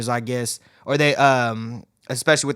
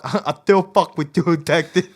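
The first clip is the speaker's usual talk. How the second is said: Mike Tyson impression; 2 semitones higher than usual. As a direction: talk like Mike Tyson, lisping. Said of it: "that Mike Tyson documentary".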